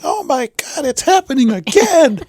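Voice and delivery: high-pitched panicked yell, mimicking mom; imitating mom yelling. Retelling mom's reaction to the truck crash, high imitated yell. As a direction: cry out in a high voice as the alarmed mother. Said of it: "I just hear Mom yelling".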